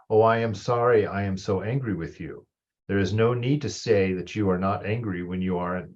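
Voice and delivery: reading scripture to a class; reading aloud from a text. Acted. Reads a passage from a text.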